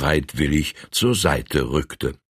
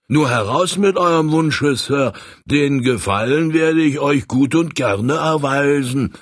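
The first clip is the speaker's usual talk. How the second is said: gleeful stoker; 8 semitones higher than usual. Acted. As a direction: cheer at the promise of brandy money. Stoker delighted at the money offer. (Heizer jubelt, lauter und höher)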